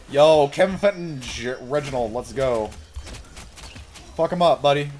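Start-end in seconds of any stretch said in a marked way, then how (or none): none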